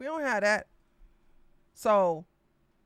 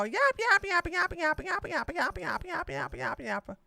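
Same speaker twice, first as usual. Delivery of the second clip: fast yapping; 6 semitones higher, 5 dB quieter than usual. Pretend. Mock yapping rattle.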